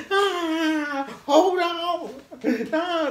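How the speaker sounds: high falsetto yelp of panic; mock scream. Caught in his friend's panic, high mock scream. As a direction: act out screaming along, very high. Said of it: "So I'm screaming now".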